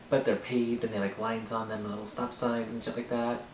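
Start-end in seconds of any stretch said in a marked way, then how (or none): none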